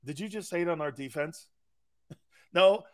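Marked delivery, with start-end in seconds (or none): 0.1-1.5 lightly voiced defensive fan, softer; a hint of an offended fan; mimicking fan. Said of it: "the dude that sits there going".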